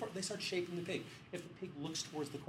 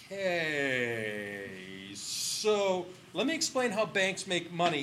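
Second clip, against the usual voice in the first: slow, louder, talking down; patronising bank guy; 3 semitones higher, 7 dB louder, much slower than usual. Retold or imitated. Bank guy explains banking to scientists, slow and patronising. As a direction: explain it like to a child. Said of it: "the bank guy's going".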